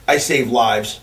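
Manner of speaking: low, quiet, solemn; foot doctor solemnly telling Paulie, low; dead serious. Retold or imitated. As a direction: say it with total gravity. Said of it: "the best line of all time".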